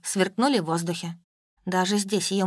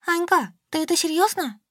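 Солька, tugging Anya's arm, asking in disbelief: high and surprised; incredulous question; 9 semitones higher than usual. A narrator's reading. Солька asks her friend about the murder: high incredulous question. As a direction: pitch jumps high on a shocked question. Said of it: "потянула меня за руку Солька".

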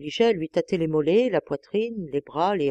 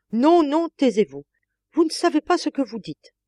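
much higher, louder cry; alarmed protest; 6 semitones higher, 6 dB louder than usual. A narrator's reading. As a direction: cut her off in alarm. Madame silencing maid in alarm.

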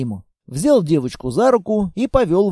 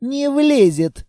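snide squeak; 6 semitones higher, slower than usual. Acted. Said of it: "с ехидцей пискнуло дитя".

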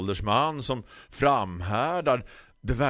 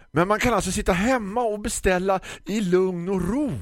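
grumpy florist; 13 semitones higher, 4 dB louder than usual. Acted. Florist lectures the customer about ordering online, high condescending voice.

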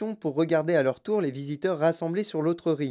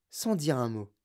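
ordinary delivery

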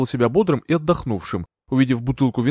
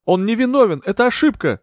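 high-pitched urgent protest; raised, high voice pleading innocence; crying out; 9 semitones higher, 4 dB louder than usual. A narrator's reading. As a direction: cry out in his defence. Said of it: "закричали Анна Фейнберг и Тилли".

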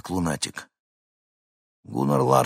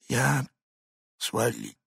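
slurred mumble; 4 semitones higher, 3 dB louder, slower than usual. A narrator's reading. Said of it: "заплетающимся языком пробормотал Хинкин".

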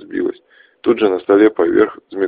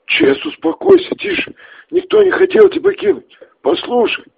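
pleading; 4 dB louder than usual. Acted. Max tries to calm Ches.

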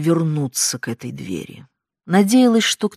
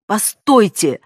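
shout; 5 semitones higher, 7 dB louder, slower than usual. A narrator's reading. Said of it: "крикнула я".

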